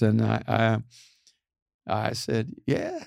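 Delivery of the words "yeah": very high, drawn-out yeah; squeaky mock-eager. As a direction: answer in a giddy little squeak. Acting out his eager acceptance.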